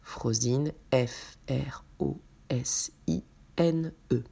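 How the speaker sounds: F R O S I N E spelled letter by letter in a whisper; spelling out a name. Shown as a demonstration. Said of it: "spelling the name out".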